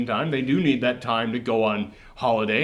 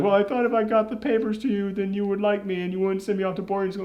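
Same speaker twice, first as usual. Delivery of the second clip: put-on higher, soft child's plea; imitated pleading daughter; 5 semitones higher, 4 dB quieter, faster than usual. Daughter explains herself to dad, pleading. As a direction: lightly act a hurt little girl.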